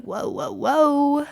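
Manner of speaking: ordinary talk, nothing marked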